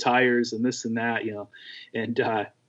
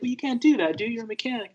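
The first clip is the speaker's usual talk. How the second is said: high, scolding buddy; imitating a friend's scolding, pitched way up; mock scolding; 12 semitones higher, faster than usual. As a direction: nag him like a know-it-all friend. Bryn scolding, voiced high.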